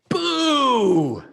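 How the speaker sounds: loud boo. Acted. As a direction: jump out and shout it. Lance scares father again.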